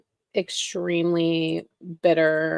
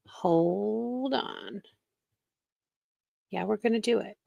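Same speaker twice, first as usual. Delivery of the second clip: usual speaking voice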